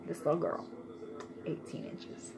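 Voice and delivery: "Eighteen inches" whispered softly. Shown as a demonstration. Mom marveling at her little girl's length, whispered. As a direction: breathe it out in awe.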